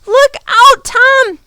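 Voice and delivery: very high, loud terrified warning; scream of fear. A narrator's reading. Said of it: "screamed with fear".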